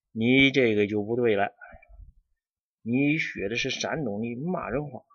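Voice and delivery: ordinary talk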